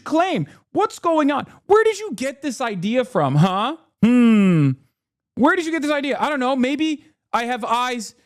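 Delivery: sarcastic high whine of faux outrage; mock gaslighting voice. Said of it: "try to gaslight, to be like".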